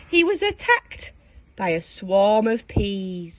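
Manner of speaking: ordinary delivery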